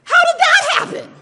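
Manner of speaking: loud, high laughing question; laughing disbelief. Real, not acted.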